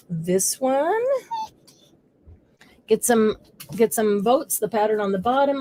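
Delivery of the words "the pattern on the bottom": ordinary delivery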